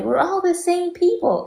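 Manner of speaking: high sing-song mock of their claim; mocking quote. Mocking the other group's claim in a high sing-song voice. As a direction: squeak it out to make them sound silly. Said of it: "talking about, like".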